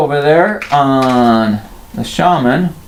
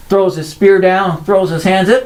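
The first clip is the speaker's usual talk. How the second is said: ordinary delivery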